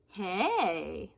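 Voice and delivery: ordinary talk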